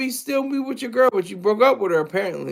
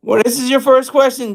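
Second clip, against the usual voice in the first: ordinary delivery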